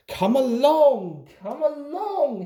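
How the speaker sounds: high chugging James; puffing engine. A narrator's reading. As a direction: puff it out like a steam engine. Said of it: "he puffed".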